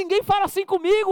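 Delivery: high, loud hammed-up shout of offence; mock angry shout. Preacher acting out a worldly quarrel, loud and angry. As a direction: yell it like a worldly hothead. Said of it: "Um fala mais grosso que o outro".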